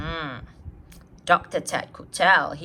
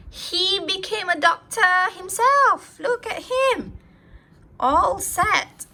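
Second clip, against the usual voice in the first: put-on reading to children; 3 semitones higher than usual. A narrator's reading. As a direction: read it brightly to a class of kids. Reads a picture book aloud.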